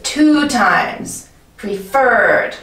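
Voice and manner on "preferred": "Preferred" with FERRED long and strong; stress on second syllable. Shown as a demonstration. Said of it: "Emphasis is on the second syllable".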